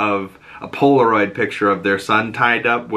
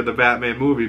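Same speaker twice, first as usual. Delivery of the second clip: ordinary talk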